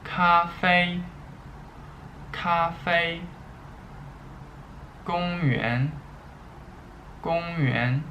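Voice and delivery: high, careful first-tone readings, each word twice; high level first tones held steady on every syllable; Mandarin tone drill. Shown as a demonstration. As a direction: read each word out clearly like a teacher modelling tones. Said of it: "Let's read them".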